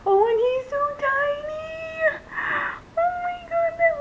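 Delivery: very high, stretched-out adoring squeal; overwhelmed squeal. Real, not acted. Overcome by the doll's face.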